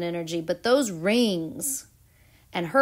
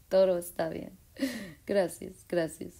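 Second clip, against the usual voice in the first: soothing Venus; 2 semitones lower, 7 dB quieter than usual. Pretend.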